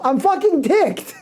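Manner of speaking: high, slow, hammed-up annoyance; mock angry. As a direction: drag it out like a fake tantrum. Mock outburst, cleaner swear.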